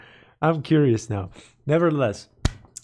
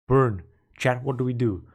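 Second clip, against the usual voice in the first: usual speaking voice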